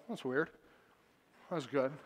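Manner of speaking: low, quiet deadpan aside; dry indifference. Retold or imitated. Dad reacting to the crying man, dry and indifferent. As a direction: toss it off softly, unmoved.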